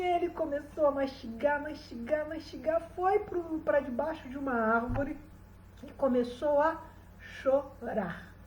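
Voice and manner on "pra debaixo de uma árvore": usual speaking voice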